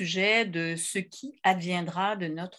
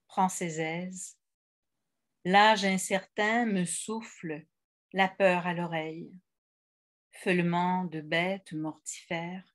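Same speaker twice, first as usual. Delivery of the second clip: poem reading. Acted. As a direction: read it as verse, unhurried, letting each line rest. Reads a friend's poem aloud.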